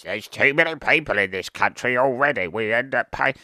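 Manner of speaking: put-on voice of a grumbler; mock an anti-immigration complainer; party-line moaner. Parroting the other side's argument, mocking put-on moan. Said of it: "That's the party line".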